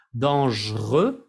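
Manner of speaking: Dangereux slowly in two syllables, /dɑ̃ʒʀø/. Shown as a demonstration. Teacher modelling Dangereux in two syllables. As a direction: say Dangereux slow, dan-gereux. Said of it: "dos sílabas".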